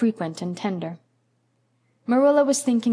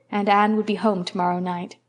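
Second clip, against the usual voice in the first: usual speaking voice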